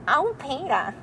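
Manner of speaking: a lightly voiced Lois from Family Guy, whining "Oh, Peter"; a hint of a nagging cartoon wife calling her husband; slight Lois voice. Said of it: "a half decent Lois from Family Guy".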